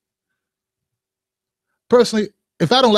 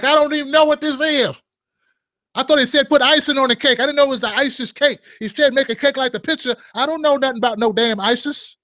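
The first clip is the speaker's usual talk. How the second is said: higher, put-on baker protesting innocence; 6 semitones higher than usual. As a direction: play the clueless baker, pitched up. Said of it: "I made him talk like that".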